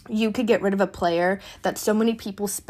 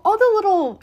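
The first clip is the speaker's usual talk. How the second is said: even higher and louder gushing; more excited; 12 semitones higher, 5 dB louder than usual. Retold or imitated. Fan reliving his hopes, louder and higher gush. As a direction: push the excitement up a notch. Recalling Brady ad hype.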